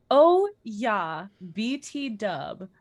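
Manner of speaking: usual speaking voice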